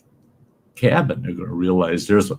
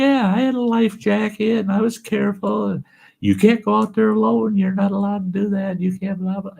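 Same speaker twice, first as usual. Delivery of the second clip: much higher, sing-song mimicry of a nagging scold; mocking the lecture; 14 semitones higher than usual. Boy mocks the superintendent's lecture, high mocking whine. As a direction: parrot the scolding in a silly high voice.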